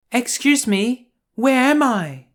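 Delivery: put-on a sweet girl refusing politely. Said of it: "she rose up and asked".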